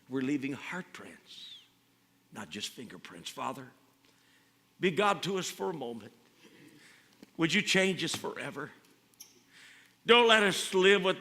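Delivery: soft pastor's prayer to end the sermon; quiet closing prayer. Real, not acted. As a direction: bring it down to a whispered-soft prayer.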